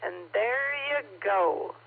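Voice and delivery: slow, drawn-out mimicry of a fellow ham; lightly voiced ham Sandy signing off; Sandy impression. [name] imitating fellow ham Sandy for the net, slow. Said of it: "my best impression of any ham I know is Sandy".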